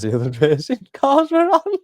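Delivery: breaking into a laugh as you say it; laughter takes over toward the end; laughing disbelief. Real, not acted. Retelling a baffling team radio message, laughing in disbelief.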